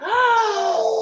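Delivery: high sharp intake of breath; startled gasp of longing. Retold or imitated. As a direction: gasp as if seeing something precious. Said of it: "And I'm like".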